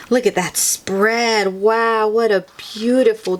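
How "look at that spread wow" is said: ordinary delivery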